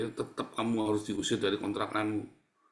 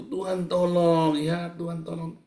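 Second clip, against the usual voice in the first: higher, stretched call that softens; acted plea; 6 semitones higher, 5 dB louder, slower than usual. Believer in the storm calling on God, pleading. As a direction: imitate someone begging God for help. Preacher mimics cry in the storm.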